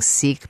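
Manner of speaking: "Sikh" with a long ee, like seek; long-ee pronunciation. Shown as a demonstration. Host explaining her first choice, long-ee "Sikh". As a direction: make "Sikh" rhyme with week. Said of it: "I started, uh, saying".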